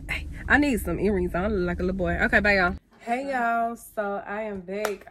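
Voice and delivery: usual speaking voice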